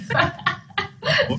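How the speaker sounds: a bright, teary burst of laughter; emotional laugh. Real, not acted. Host laughing, moved by the horse story, a teary laugh. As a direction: laugh it out, half overcome.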